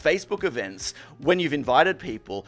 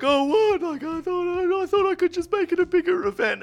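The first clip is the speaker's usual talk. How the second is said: high whiny mocking voice; whiny mock John. Mocking his friend John to a mate, high and whiny. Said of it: "so he's like".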